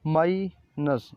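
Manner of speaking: माइनस said slowly, mai-nas, before spelling it; slow spelling demo. Shown as a demonstration. Teacher saying the word slowly before spelling it. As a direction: break माइनस into slow clear syllables. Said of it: "तो spell कैसे करेंगे".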